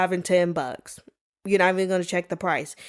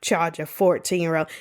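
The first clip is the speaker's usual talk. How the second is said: ordinary delivery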